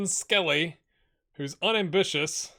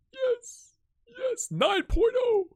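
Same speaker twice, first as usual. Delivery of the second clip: much higher, excited yes; delighted squeal; much slower than usual. Real, not acted. Player reacts to a nine point oh rating, much higher and excited.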